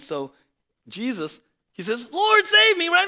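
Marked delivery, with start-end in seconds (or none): 2.1-3.1 loud, high plea for rescue; desperate cry. Retold or imitated. Peter sinking cries to Jesus, loud desperate plea. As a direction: call out like a drowning man.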